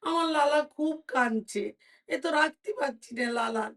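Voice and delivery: put-on a mother who can't calm her wailing baby; fretting mother. Queen frets to the king over her crying son, high distressed voice.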